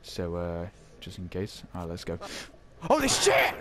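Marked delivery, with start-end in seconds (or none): 2.8-3.5 sudden high startled yell; startled shout. Real, not acted. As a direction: jump out of your skin. Jump scare in game.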